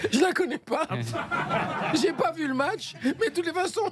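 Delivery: high laughing admission. Real, not acted. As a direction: laugh through the confession. Laughing confession.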